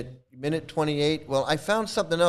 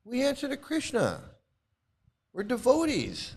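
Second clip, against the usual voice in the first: mock smug swami, higher; 8 semitones higher, 3 dB quieter than usual. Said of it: "their mentality is".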